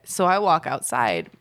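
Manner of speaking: ordinary delivery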